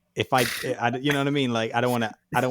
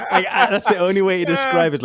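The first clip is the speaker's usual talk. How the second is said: laughing; 8 semitones higher, 8 dB louder than usual. Real, not acted. Laughing at the bad accent.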